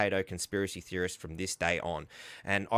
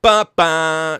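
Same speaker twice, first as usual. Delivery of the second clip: loud mock buzzer; 5 semitones higher, 13 dB louder than usual. Buzzer noise rejecting claim.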